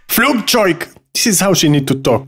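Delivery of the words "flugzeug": high, forceful mock-angry German word; exaggerated harsh German pronunciation. Shown as a demonstration. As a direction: imitate an angry German speaker. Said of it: "Angry German kid".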